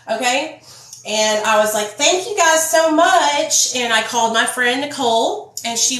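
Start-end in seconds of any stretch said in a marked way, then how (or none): 1.9-3.7 high, loud, drawn-out gratitude; slow sing-out of thanks, pitched up; gushing thanks. Retold or imitated. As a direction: re-enact effusive thanks, stretched and sugary. Acts out thanking the helpers.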